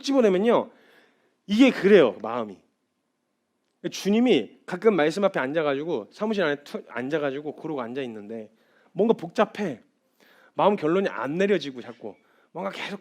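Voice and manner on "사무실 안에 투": usual speaking voice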